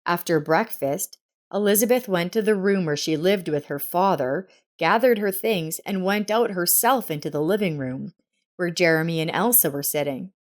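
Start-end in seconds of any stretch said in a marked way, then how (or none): none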